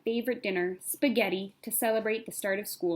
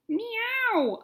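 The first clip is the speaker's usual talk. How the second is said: high cat meow; 14 semitones higher than usual. Acted. Cat yowls when wrapped.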